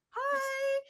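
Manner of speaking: very high, drawn-out dog greeting; friendly dog voice. Acted. Dog greeting a barking Malinois, high friendly voice.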